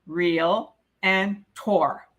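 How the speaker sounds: real and tor, split and very slow; two syllables, slowly. Shown as a demonstration. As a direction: say real, pause, then tor. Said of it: "There are two syllables".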